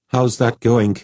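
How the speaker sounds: mildly haughty curiosity; lofty. A narrator's reading. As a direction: ask it from a lofty height. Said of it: "asked with a lofty air".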